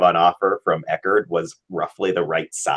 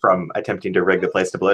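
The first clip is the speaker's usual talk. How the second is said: ordinary talk, nothing marked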